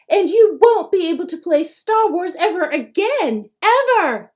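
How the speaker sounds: big brother scaring the little one; fierce. A narrator's reading. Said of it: "says Frank fiercely".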